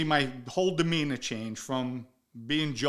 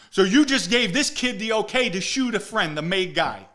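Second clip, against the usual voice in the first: loud, raised accusing voice; angry accusation; 6 semitones higher, 7 dB louder than usual. Retold or imitated. Accusing Blaze of letting the kid shoot him, heated. Confronting Blaze about Andrew.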